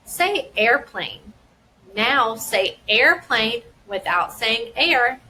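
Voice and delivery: slow, clear instruction, word by word; slow teacher prompt. Shown as a demonstration. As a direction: guide a child slowly through the task. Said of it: "would be".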